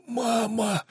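a strained hoarse plea; hoarse cry. Acted. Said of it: "прохрипел Дэн".

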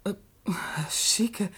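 zu Tode erschreckt, gehaucht und langsam; geflüstert, als hätte sie ein Geist erschreckt; erschrocken. Acted. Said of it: "Sie haben mich vielleicht erschreckt". (erschrockene Sekretärin haucht den Namen des Studenten)

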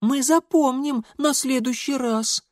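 put-on high-pitched childish promise; little girl. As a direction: pipe it like a naughty child. Little sisters chime in.

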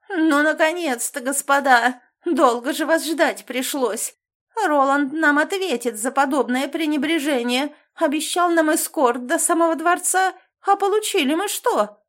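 high, loud, capricious aristocrat; mannered whiny voice. Acted. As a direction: play a spoiled hysterical noble. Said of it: "противный и манерный голос Кирилла". (капризный манерный голос аристократа)

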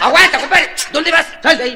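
loud, high stop-right-there call; bouncer shout. Acted. Doorman stops the rider at the door, loud and high. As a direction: bark at the intruder.

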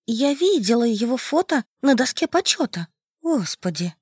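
put-on high shaken young woman; fighting back tears and nausea; trembling voice. Masha shaken over the body. (дрожащий голос в ужасе)

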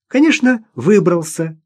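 high, confident lift; proud. A narrator's reading. Girl who saved him confirms it, proud. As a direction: toss it off proudly, starting high. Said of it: "гордо сказала Маша".

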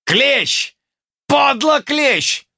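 high-pitched outraged cry; angry shout. A narrator's reading. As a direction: yell it high across a melee. Crowd shouts at the traitor. (возмущённый крик)

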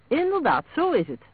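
high, lively, amused delivery; amused agreement. A narrator's reading. Ina agreeing about her husband's suspicious nature, amused. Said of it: "lachte Ina".

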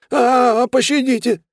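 high, loud cry for mercy; scream, then beg in a high voice; pained plea. A narrator's reading. Zapped thug begs for mercy. (вопить и молить о пощаде)

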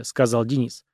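ordinary delivery

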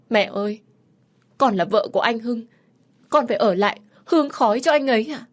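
distraught, insistent begging; desperate pleading. A narrator's reading. Daughter-in-law begs to stay.

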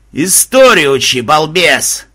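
loud, drawn-out telling-off; scolding. A narrator's reading. Banzai scolding Mahmud from the sky, loud rebuke. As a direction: snap loudly at a dunce.

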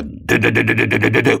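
rapid stuttered machine-gun imitation; пулемётная очередь. A narrator's reading. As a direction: rattle it off like gunfire. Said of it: "будто строчит из пулемета". (трещит как пулемёт)

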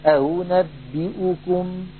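أأنبئكم slowly, second hamza between hamza and waw; teaching recitation; eased damma hamza. Shown as a demonstration. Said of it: "فإن ورشا يقول".

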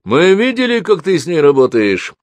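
high, loud menacing outburst; shouted. Acted. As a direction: yell it at him, threatening. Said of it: "выкрикнул брат Гулии".

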